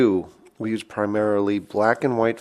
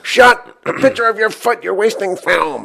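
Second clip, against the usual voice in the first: scolding parent; 9 semitones higher, 4 dB louder than usual. Retold or imitated. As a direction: imitate a parent ranting about waste. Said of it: "they'd be like".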